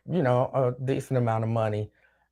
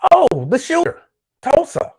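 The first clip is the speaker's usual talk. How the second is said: "oh" leaps much higher and louder as it comes to him; sudden recall; 11 semitones higher, 8 dB louder than usual. Real, not acted. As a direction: blurt it out as it clicks. Suddenly remembering the topic.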